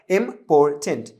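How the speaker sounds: "Important" in three separate beats, im-por-tent; broken into syllables. Shown as a demonstration. Teacher recapping how the word was split, "important" in three pieces. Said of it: "कैसे, कैसे तोड़ा".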